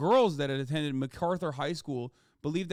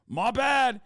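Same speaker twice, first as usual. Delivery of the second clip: louder, higher jeering caricature of a careless bro; mocking dude voice; 5 semitones higher, 6 dB louder, slower than usual. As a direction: ham up a shrugging bro. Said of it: "and going".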